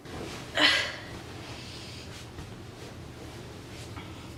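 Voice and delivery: long unvoiced sigh. Real, not acted. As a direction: let out a long breath before speaking. Long pause before personal news.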